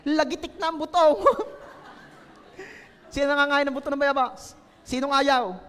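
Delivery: ordinary talk, nothing marked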